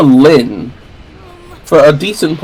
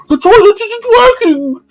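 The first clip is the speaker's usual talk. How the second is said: very high put-on granny voice; grandma reporting the toilet, very high mock voice; grandmother. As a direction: complain like a cartoon granny. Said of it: "the grandmother comes back like".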